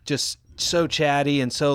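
ordinary talk, nothing marked

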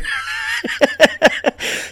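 very high wheezing cackle; high-pitched laughter. Real, not acted. Comic cracking up over the dog picked up like a sneaker, high laughter. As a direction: lose it laughing mid-story.

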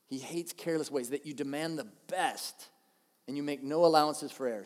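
ordinary talk, nothing marked

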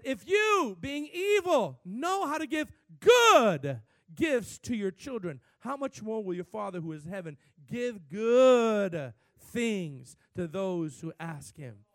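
loud, high shout, slowing at the end; preacher shouting Jesus' words to the church; shouted scripture. Acted. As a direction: thunder it, then let it settle.

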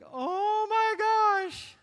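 high, loud, drawn-out gasp of dismay; mock horror at the muddy floor; shocked parent. Parent seeing mud tracked everywhere, shocked.

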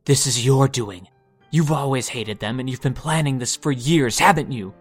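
loud, strained accusing voice; rising anger, shouting her down; shrill and grating. Acted. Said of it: "his voice grating and shrill against her calm".

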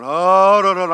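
high, loud wailing cry; retold scream. Preacher reliving intercession for a lost soul, screaming. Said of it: "I'm starting to scream".

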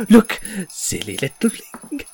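high teasing jester jeer; sing-song fool's taunt; mocking jester. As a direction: jeer up at the tree. Jester points at cat in tree.

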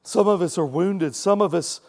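humble prayer with long pauses. Acted. Closing prayer.

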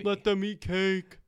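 hammed-up haughty queen; mock royal quote. Mocking French aristocrat quote.

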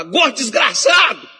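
high, slow, loud demon shout. Retold or imitated. Demon now mocking the fat pastor, shouting. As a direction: bellow the insult with mock menace. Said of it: "Agora ele fala".

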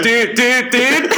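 panicked dude. Retold or imitated. Kid hoisted on a bully's shoulders, panicked high pleading. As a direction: squeal it like you're being lifted. Lifted onto kid's shoulders.

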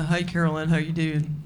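a mild take on the sitcom star's greeting; understated celebrity impression; Brett Butler impression. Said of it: "the impression will be spot on".

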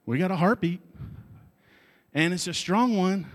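retelling the medics' excited shout. Retold or imitated. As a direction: call out the good news. Medics find the heartbeat.

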